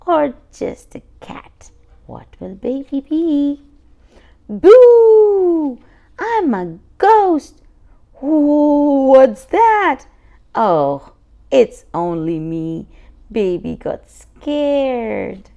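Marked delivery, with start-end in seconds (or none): 4.5-10.0 high, loud ghost boo; little ghost scaring the family, loud; playful boo. Acted. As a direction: jump out and shout boo. Baby jumps out in costume.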